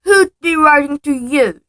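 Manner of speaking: mock the boy with scorn; sneering uncle. Uncle sneering at Harry over the letter. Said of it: "sneered Uncle Vernon".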